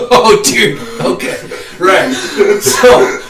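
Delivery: loud burst of laughter; laughing loudly while trying to talk. Real, not acted. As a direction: crack up laughing at the memory. Laughs at ref's cracking voice.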